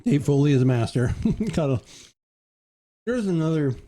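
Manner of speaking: talking through a mouthful of caramel; chewing while speaking; mouth full. Real, not acted. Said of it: "talking with my mouth full of sea salt caramel".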